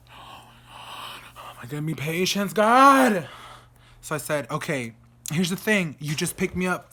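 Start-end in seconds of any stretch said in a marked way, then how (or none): 0.1-3.3 hushed start, loud exasperated finish; exasperated plea. Retold or imitated. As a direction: mutter building to a loud cry. Retold exasperated prayer for patience.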